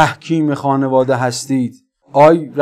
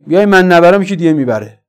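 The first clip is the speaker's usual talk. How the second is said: ordinary talk, nothing marked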